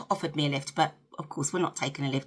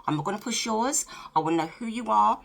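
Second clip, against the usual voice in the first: ordinary delivery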